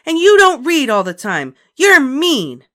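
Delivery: put-on high, loud child shout. A narrator's reading. Ramona shouting at her sister, high and loud. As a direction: shout it back. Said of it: "shouted Ramona".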